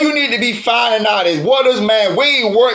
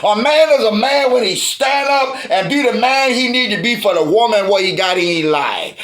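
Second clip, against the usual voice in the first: normal talking voice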